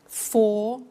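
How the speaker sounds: usual speaking voice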